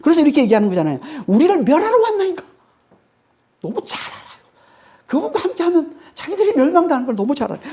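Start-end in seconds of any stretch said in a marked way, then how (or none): 1.3-2.4 high-pitched, alarmed mock protest; acted demon outcry. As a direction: cry out high as a demon facing destruction. Preacher acts demons confronting Jesus. (멸망을 아는 귀신의 높은 외침 흉내)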